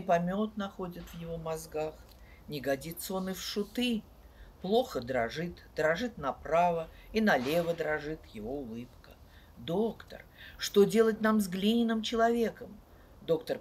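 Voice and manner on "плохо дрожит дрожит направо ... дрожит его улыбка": ordinary delivery, nothing marked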